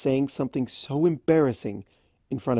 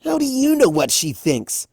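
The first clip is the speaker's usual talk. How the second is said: much higher, louder tomboy shout; shouted challenge; 13 semitones higher, 6 dB louder than usual. A narrator's reading. Rainbow shouting down at Raspberry, challenging. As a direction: yell it down from the sky. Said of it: "Rainbow shouted from above".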